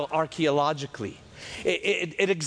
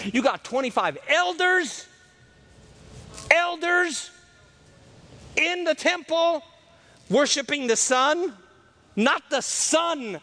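preaching peak; 9 semitones higher than usual. Real, not acted. Preacher to church, crying out high about the elders. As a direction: lift the congregation with a rising cry.

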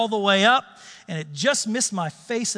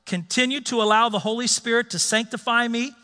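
usual speaking voice

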